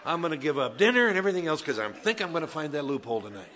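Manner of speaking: loud, fast, laughing mock voice; mock loophole seeker. Pastor mocking imagined churchgoers, loud and laughing. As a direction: ham up a sinner hunting loopholes. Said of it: "showed up tonight saying".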